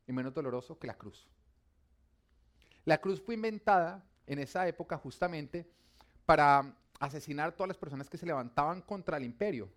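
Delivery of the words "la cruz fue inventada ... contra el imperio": loud, rising sermon delivery; impassioned preacher building in volume; forceful preaching. Real, not acted. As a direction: drive the point home to the congregation.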